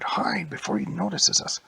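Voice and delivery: panicked stage whisper; urgent hiss. Acted. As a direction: hurry your friend into hiding. Kid urges friend to hide.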